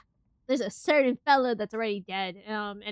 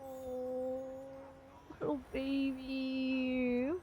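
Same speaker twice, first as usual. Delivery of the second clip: soft cooing; 11 dB quieter, much slower than usual. Real, not acted. Cooing at the dog.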